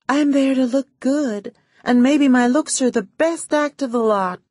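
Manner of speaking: a glamorous actress laughing off her own acting; movie starlet. A narrator's reading.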